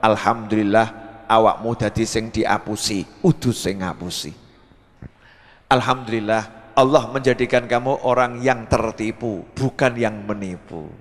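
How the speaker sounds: low, unhurried elder's answer; Mbah Umar. Acted. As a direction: answer calmly in a deep, settled voice. (jawaban sesepuh, rendah dan tenang)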